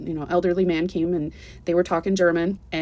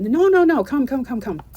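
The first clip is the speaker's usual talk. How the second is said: high, louder eager insistence; insistent welcome; 7 semitones higher, 4 dB louder than usual. Retold or imitated. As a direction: brush off her apology and pull her in. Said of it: "relatives insisting she come in".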